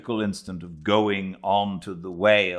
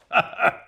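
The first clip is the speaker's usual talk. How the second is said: short high gleeful laugh; Flask's laugh; 5 dB louder than usual. Acted. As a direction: burst out laughing at the joke. Flask laughs mid-boast.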